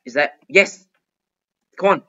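ordinary delivery

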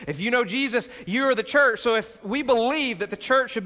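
rally the congregation; energetic pulpit delivery; full-force preaching. Acted.

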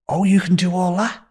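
high, rising question of mild astonishment; impressed surprise. Retold or imitated. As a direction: imitate a pleasantly surprised interviewer. Interviewer impressed by his skills.

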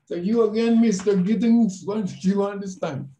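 high, loud, cheery Spanish lady's greeting; lightly voiced cheerful manager; imitating the manager. Manager greeting him again, voiced.

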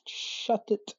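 voiceless curt order; whispered snap. Acted. Telling someone to shut it, in a whisper. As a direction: hiss it in a whisper. Hissed rebuke.

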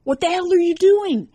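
startled, high and loud; stammered. A narrator's reading. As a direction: blurt it out at the intruder. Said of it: "Matt stammered".

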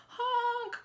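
high, frightened honk; a horn crying for help; scared honk. A narrator's reading. Stuck dump truck honks, scared, for help. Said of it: "cried the dump, and he sounded scared".

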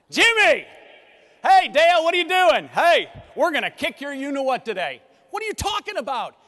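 high, booming shout across the track; calling out loudly. Acted. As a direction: holler boisterously to a rival driver. Drivers shouting across to each other.

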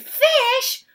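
very high, loud shriek of disgust; screaming. A narrator's reading. Guest discovers what she has bitten, screaming. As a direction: shriek it in revulsion, building on the repeat. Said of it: "she screamed".

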